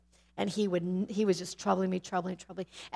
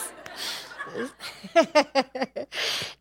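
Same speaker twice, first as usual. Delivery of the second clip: long real laugh; 5 semitones higher than usual. Real, not acted. Laughs after slip.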